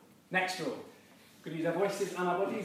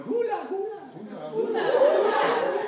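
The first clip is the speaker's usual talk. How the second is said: high, hammed-up hula chant, very slow; hula hoop champion; 9 dB louder, much slower than usual. Shown as a demonstration. Game leader shows the group the hula reply, squealing. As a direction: squeal it out like you're winning a hula hoop contest. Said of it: "you're in a hula hoop competition".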